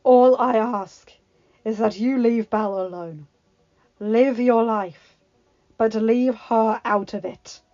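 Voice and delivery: firm beast voice. Acted. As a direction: lay down the terms, unhurried. Beast's calm demand.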